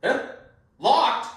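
startled to find it locked; jolted by a locked door; surprised. Acted.

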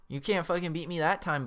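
usual speaking voice

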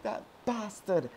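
high-pitched mock resentment; imagined rival's grumble. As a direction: voice the robbed player's complaint. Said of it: "He's probably like".

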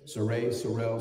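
"Saray, Sorrell" low and soft, played with; "Saray" then "Sorrell", quiet and teasing; name variations. Shown as a demonstration. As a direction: toy with the sound of the name. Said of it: "saying your name three different ways".

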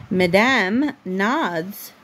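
ordinary talk

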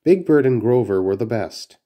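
usual speaking voice

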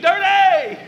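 loud high shout across a parking lot; a guy yelling across the lot; screamed name. Retold or imitated. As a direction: yell the name from far off. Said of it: "a guy screamed".

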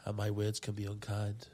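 heartfelt poem recital; poem reading. Acted. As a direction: read it slow and sincere. Reads love poem aloud.

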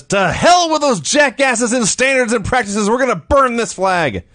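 high-pitched ranting wrestler threat; mock angry promo. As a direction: imitate a heel working up the crowd.

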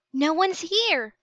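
high, bright shouted reply; a girl shouting down to her brother; shouting back. A narrator's reading. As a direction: shout it down to him. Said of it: "she shouted back".

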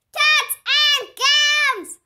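high, loud, slow group holler; the whole class yelling it back. Acted. Room nine hollering back to Junie, high and loud. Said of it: "hollered room nine".

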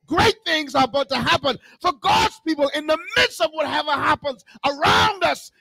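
high, impassioned sermon delivery; full-force preaching. Real, not acted. Preacher telling someone today great things are coming, fervent preaching. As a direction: drive the promise home to the congregation.

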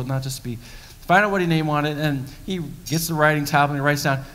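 usual speaking voice

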